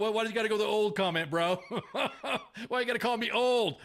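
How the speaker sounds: laughing, high-pitched playful protest; mock offended. Older host to younger guest over the old comment, mock offended. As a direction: protest through laughter, pretending to be hurt.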